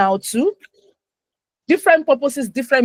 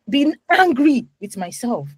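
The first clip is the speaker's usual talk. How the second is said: usual speaking voice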